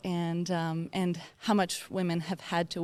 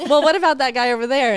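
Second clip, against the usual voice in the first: loud, fast, higher incredulous customer; entitled customer impression; 6 semitones higher, 11 dB louder, faster than usual. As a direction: refuse to believe she's the manager. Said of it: "they'd be like".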